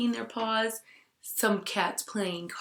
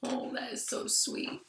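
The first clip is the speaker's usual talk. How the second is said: tender aside; 2 semitones higher, 6 dB quieter than usual. Real, not acted.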